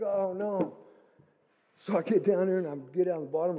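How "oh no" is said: a high, sinking oh no; the sheep keep climbing; dismayed oh no. Retold or imitated. Watching the flock run off, dismayed. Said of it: "And I'm like".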